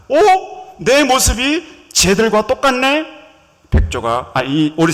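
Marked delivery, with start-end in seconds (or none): 0.1-3.1 much higher, loud, slow gasp of wonder; amazed discovery. Acted. Duckling sees himself in the water, astonished. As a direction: marvel at your own reflection.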